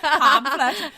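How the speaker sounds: pamphlet, said with an exaggerated Welsh accent, laughing. Shown as a demonstration. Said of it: "And the Welsh accent's gone".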